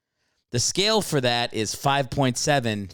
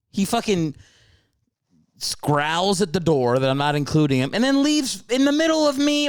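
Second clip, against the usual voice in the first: mock outrage; 6 semitones higher than usual. Real, not acted. Host ranting to listeners that his dog walked out, in mock outrage. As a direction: act scandalised the dog left you.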